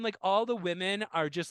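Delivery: ordinary delivery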